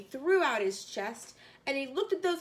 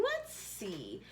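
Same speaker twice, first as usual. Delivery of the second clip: high and soft; 10 semitones higher, 7 dB quieter than usual. Acted. Horton thinking aloud.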